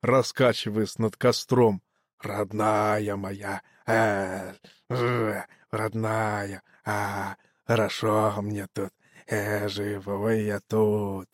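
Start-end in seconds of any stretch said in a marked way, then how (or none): 2.2-11.3 low, soft corpse croak; hoarse wheezing. A narrator's reading. Dead man swaying over the fire, low soft wheeze. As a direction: croak slowly and quietly, as if to yourself. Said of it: "Дохлятина продолжала хрипеть".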